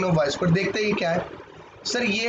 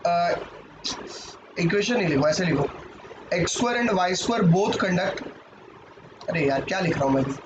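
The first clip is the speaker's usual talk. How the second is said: ordinary talk, nothing marked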